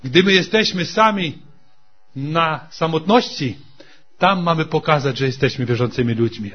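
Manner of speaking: usual speaking voice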